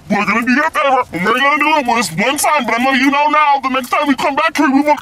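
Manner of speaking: high mock-official warning; mocking manager voice. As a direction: ham up the smug worker lecturing you.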